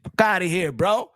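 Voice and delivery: ordinary talk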